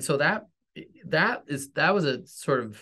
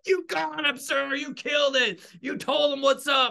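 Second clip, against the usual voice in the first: high, hyped-up soldier voice cheering the boss on; 13 semitones higher than usual. Retold or imitated. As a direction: play the crew egging their commander on. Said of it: "the men on my tank are like".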